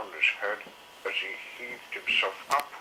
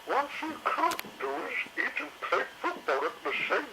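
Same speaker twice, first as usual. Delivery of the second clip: much higher, put-on matron voice; high lady dinosaur teasing; cheerful Missus Carey. A narrator's reading. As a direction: tease them kindly.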